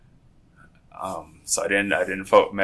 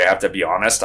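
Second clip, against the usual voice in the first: normal talking voice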